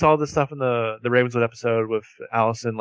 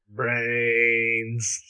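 zombie moan; 5 dB quieter than usual. Pretend. Joking [name] answers Mona as a zombie, soft mock moan. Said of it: "just turn to her and be like".

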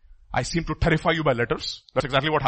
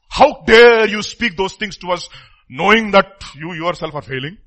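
loud, slow, outraged challenge; indignant outburst; 7 dB louder than usual. Acted. Congregant angrily confronting the pastor, loud indignant outburst. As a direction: hurl each how dare you at the pastor. Preacher voices angry congregation member.

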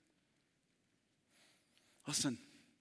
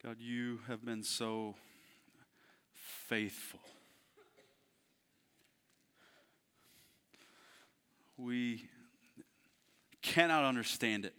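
quiet prayer; 4 semitones lower, 5 dB quieter than usual. Acted. Closing prayer.

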